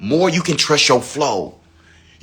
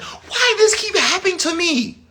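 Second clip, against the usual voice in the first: high, whiny self-pity; mock victim. Mocking a victim mindset, high whiny voice. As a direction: parody the self-pitying question.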